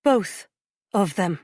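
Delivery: quiver in her voice. A narrator's reading. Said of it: "the quiver in her voice".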